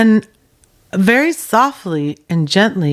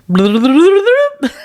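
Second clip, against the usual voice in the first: laugh; 8 semitones higher than usual. Real, not acted. Laughing as they rush out.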